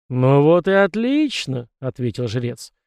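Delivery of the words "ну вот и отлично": slow, measured priest's voice. A narrator's reading. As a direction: speak slowly, like a calm priest. Priest's voice on phone.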